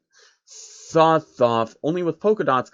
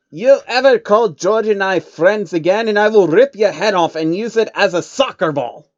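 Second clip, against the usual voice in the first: menacing threat; 7 semitones higher, 7 dB louder than usual. Acted. Jacob threatens the changeling.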